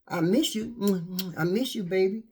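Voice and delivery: soft, loving "I miss you"; tender. Retold or imitated. Partner telling partner they missed them, soft and tender. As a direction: lean in close and soothe. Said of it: "To comfort one another".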